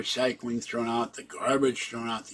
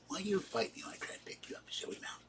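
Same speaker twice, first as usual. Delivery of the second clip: fast, tender whisper to a squirming cat; hushed scolding of a pet, real; whispering to Fluffy; 8 semitones higher, 14 dB quieter, faster than usual. Real, not acted. Talking to the cat.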